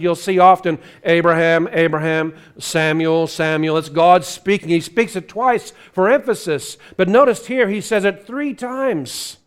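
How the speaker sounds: normal talking voice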